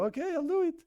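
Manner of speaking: high-pitched pretend giving in; playful squeak of compliance under threat; mock surrender. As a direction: give in hastily, voice jumping up high.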